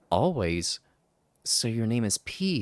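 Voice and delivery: normal talking voice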